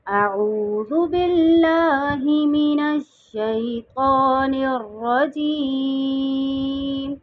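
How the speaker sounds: slow, drawn-out melodic tajweed recitation; Quranic recitation. Shown as a demonstration. Teacher opening the lesson by reciting, slow melodic recitation. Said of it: "तलव्वुज़ और तस्बीह की तिलावत से करते हैं".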